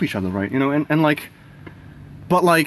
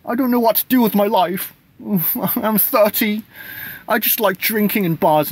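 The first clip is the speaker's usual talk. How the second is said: high, pitiful whimpering self-pity; hammed-up sad sack voice; mock whiny crying man; 10 semitones higher than usual. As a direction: whine it like a hopeless grown man. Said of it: "the man who's crying and like".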